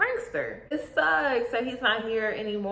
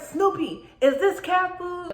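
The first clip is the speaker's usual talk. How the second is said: higher pitched, indignant demand; accusing kid; 7 semitones higher than usual. Retold or imitated. Little sister accusing brother Snoopy, higher indignant demand. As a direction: confront him by name. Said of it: "I was just going off".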